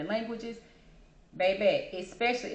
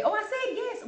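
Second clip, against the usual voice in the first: much higher, airy mock innocence; mock surprised reply; 13 semitones higher than usual. Mocking the person who said yes. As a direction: play the oblivious rambler. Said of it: "the person would say".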